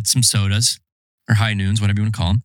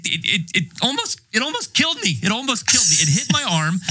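much higher, voice jumping up in alarm; excited disbelief; 9 semitones higher than usual. Real, not acted. Telling his friend John about the trap, much higher and alarmed. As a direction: still shaken, pitching way up over a near miss. Nearly impaled by a mole trap.

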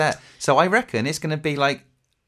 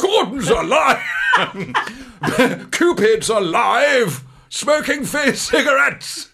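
slow, much higher booming shout through laughter; Brian Blessed shout; 6 semitones higher, much slower than usual. Retold or imitated. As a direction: bellow it like a theatrical giant, laughing. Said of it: "You want to make Brian Blessed".

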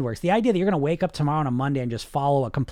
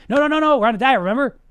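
much higher and louder, an exasperated no-no-no; loud scolding; 8 semitones higher, 6 dB louder, faster than usual. Acted. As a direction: slam the brakes on the craving. Conscious mind scolding again, stronger.

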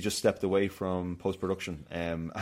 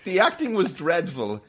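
much higher, louder indignant rant; mock outraged review reading; 9 semitones higher, 4 dB louder than usual. Host reads a one-star review in mock outrage. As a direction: read it like a furious critic.

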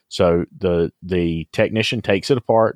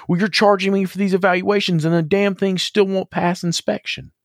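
imitating an upset young customer, much higher; mock outraged customer; 10 semitones higher than usual. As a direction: complain hotly about paying for evaluations. Angry customer voiced in story.

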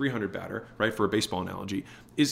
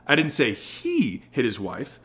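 HE stretched and raised in pitch; stress on HE; slower than usual. Shown as a demonstration. Sales coach shows how emphasis changes meaning, stress on HE. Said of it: "the emphasis that we put on the words".

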